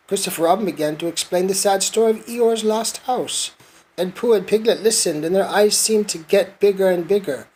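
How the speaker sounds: ordinary delivery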